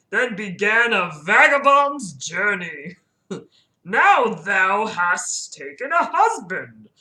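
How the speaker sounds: put-on slow, grand Old English oration; ancient talking tree. Deku Tree addresses Jenna's life, slow and grand. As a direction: intone it ponderously, stretching every phrase. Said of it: "Deku Tree speaks in Old English".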